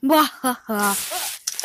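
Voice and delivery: slow drawn-out evil cackle; mad scientist laugh. Pretend. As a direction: cackle like a cartoon villain. Villain toy evil laugh.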